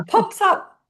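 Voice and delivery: high, slow pop up; popping up. Shown as a demonstration. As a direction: let it spring up. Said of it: "imagine this pop-up laughter".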